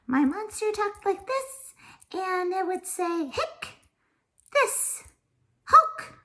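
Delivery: very high, squeaky little puppet monster; high-pitched monster voice. Shown as a demonstration. Teacher voicing a finger-puppet monster for Latin, high-pitched. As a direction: pipe up like Elmo, words drawn out. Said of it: "do it in a high-pitched voice".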